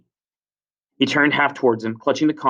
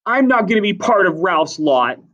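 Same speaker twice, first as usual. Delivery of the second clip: loud, high refusal; angry defiance; 8 semitones higher, 6 dB louder than usual. Acted. Jack breaks from Ralph's lot, loud defiance. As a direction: announce the break forcefully.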